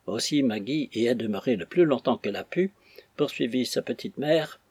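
usual speaking voice

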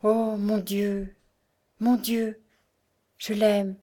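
slow, stunned cry of dismay; distraught cry. A narrator's reading. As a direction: gasp it out, overwhelmed. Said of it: "elle s'écria éperdue".

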